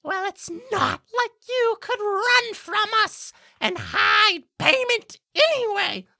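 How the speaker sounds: very high, loud bird voice; squawky screech, far from a whisper; blackbird at the ear. Acted. Blackbird on the player's shoulder, loud high screech. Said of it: "whisper in your ear and say".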